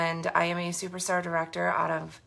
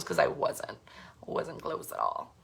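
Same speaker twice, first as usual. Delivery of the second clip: whispered admission; 10 semitones lower, 7 dB quieter than usual. Real, not acted. Admitting failure.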